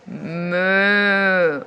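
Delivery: loud lowing moo; cow moo. Shown as a demonstration. Teacher modelling a moo for the class. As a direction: show the kids the moo again. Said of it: "How about you?".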